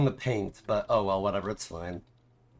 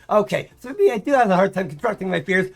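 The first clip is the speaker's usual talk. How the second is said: higher, louder, fast whiny rant; ranting coward; 8 semitones higher, 5 dB louder, faster than usual. Retold or imitated. Fired pilot ranting after losing his job, mock whiny. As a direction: rattle off excuses for being a coward. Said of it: "he has this rant".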